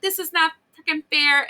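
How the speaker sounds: high, mock whine like a sulking kid; whiny sulking viewer. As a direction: whine it like a child having a tantrum. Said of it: "you're like".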